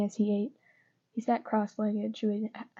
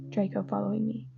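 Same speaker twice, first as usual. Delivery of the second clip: usual speaking voice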